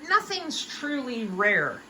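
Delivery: ordinary talk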